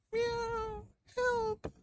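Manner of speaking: very high meowing cry for help; crying cat. Acted. Trapped cat crying for help, very high. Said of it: "he could hear".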